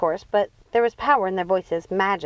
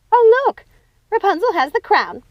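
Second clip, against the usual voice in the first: much higher, loud delighted cry; thrilled gasp of delight; excited; 12 semitones higher, 7 dB louder than usual. Acted. Sister spotting Rapunzel with the crown, high and loud.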